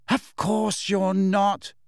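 high, drawn-out, absent reassurance. A narrator's reading. Hermione scribbling at her letter, absent. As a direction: toss it out slowly while half elsewhere. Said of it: "said Hermione absently".

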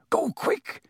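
high, hoarse urgent order. A narrator's reading. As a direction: urge them away in a strained, hoarse voice. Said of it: "he said hoarsely".

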